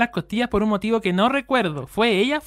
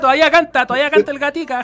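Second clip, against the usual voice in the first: excited; 5 semitones higher, 4 dB louder than usual. Real, not acted. Teasing about old singer.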